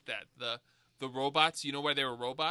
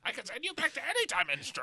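character voice; 7 semitones higher, faster than usual. Retold or imitated. Quoting Gwildor's line.

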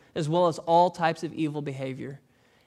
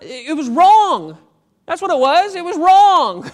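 emphatic preaching; 11 semitones higher, 13 dB louder than usual. Real, not acted. Pastor preaching at full force.

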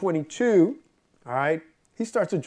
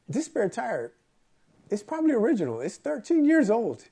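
higher-pitched worried retelling; mock-worried, pitched up; retold freaking out; 6 semitones higher than usual. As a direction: mimic your own fretting over a risky journey. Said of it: "on the way there freaking out".

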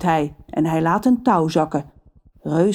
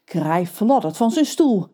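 ordinary delivery

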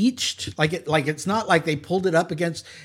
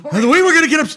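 higher, louder Bernie Sanders-style captain; old-campaigner bluster as a boat captain; Bernie Sanders impression; 4 semitones higher, 4 dB louder, faster than usual. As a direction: pitch it up and punch it like Bernie Sanders. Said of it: "this Bernie Sanders guy".

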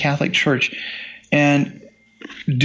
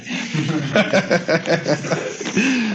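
chuckling laugh; 2 semitones higher than usual. Real, not acted. Laughs after punchline.

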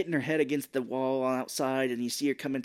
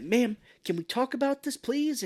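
high mock pleading; imploring actor imitation, pitched way up; Mark Wahlberg; 10 semitones higher than usual. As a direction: plead politely in a silly high voice. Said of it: "he's like".

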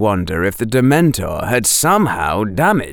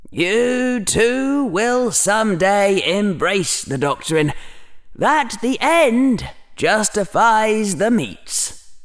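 slow, drawn-out grim prophecy; 9 semitones higher, slower than usual. A narrator's reading. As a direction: intone it slowly, with solemn weight. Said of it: "Slytherin's mental voice was grim".

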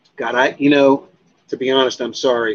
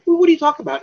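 much higher, indignant disbelief; incredulous protest; 13 semitones higher, much faster than usual. Retold or imitated. Retelling his bosses' news, high incredulous protest. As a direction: throw it back at them, stunned and offended.

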